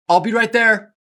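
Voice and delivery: high and loud, called across the shop; calling out. Acted. Clerk calling to a customer from the back, loud call. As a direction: call out toward the door.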